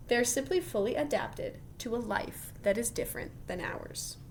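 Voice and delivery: ordinary talk, nothing marked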